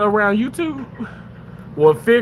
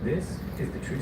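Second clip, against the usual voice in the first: whisper; 5 semitones lower, 11 dB quieter, faster than usual. Pretend. Whispered aside.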